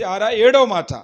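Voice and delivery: normal talking voice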